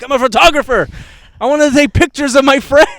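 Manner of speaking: high, very loud proclamation; hammed-up yell of wounded innocence; indignant drunk shout. Friend showing police a professional camera, indignant shouting.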